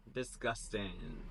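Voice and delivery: hushed, slow disgust; muttered. Acted. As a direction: read it soft and slow with distaste. Jesus reads insult.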